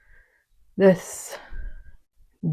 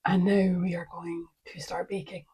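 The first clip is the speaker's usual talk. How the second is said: whispering; 10 dB quieter than usual. Real, not acted. Whispered aside while filming.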